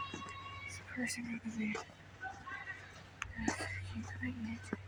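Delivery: hushed whisper so the passerby won't hear. Real, not acted. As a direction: whisper under your breath. Whispers when a person is near.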